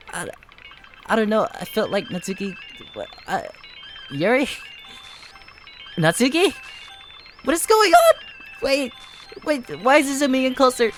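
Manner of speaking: ordinary talk, nothing marked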